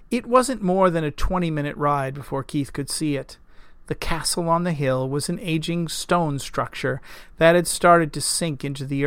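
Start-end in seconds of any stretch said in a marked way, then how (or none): none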